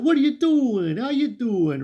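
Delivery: tossing off a lightly hammed family hello; Brooklyn family voice. Mario's family greeting each other, Brooklyn voice. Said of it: "We see a whole bunch of".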